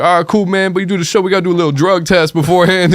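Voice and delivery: high, exaggerated put-on voice of a prank caller. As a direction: ham up a fake street voice.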